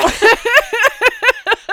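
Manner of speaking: high burst of helpless laughter; real laughing fit. Real, not acted. Laughing fit at a friend's silly noises. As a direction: cracks up at the gun noises.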